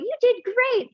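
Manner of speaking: a sugary, very high "you did great"; high mock praise. To the class, mocking the praise she gets, high and sweet.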